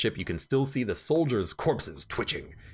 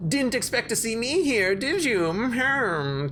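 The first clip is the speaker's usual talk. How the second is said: gloating villain; 12 semitones higher than usual. Acted. Smug villain hails the player.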